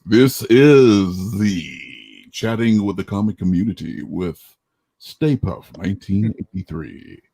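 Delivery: slow, commanding show announcer. Acted. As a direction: introduce the show like a TV promo. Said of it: "my announcer voice".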